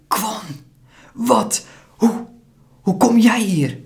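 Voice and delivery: put-on a startled boy stammering; stammering in shock. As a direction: stumble over the words, stunned. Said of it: "stamelt hij".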